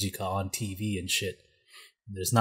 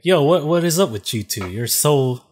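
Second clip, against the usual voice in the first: higher and louder, exasperated; mock yelling at guests; 5 semitones higher, 7 dB louder than usual. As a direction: call them out for being noisy. Family scolding noisy guests.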